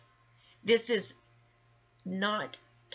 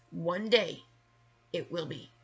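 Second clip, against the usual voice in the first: ordinary talk, nothing marked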